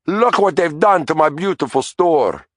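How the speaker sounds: put-on high, lamenting Italian accent; wailing Italian accent. A narrator's reading. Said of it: "he said".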